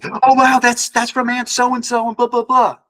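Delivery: mock excitement. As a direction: parody someone gushing over a sign. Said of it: "and you say".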